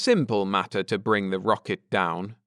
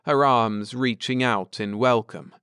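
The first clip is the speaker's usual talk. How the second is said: ordinary delivery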